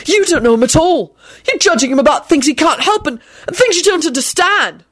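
high, heated defence; indignant shout. A narrator's reading. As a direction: protest hotly on a friend's behalf. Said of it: "Neville shouted as he stood up".

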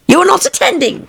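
high severe scolding; stern mouse. A narrator's reading. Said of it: "said the mouse to Alice severely".

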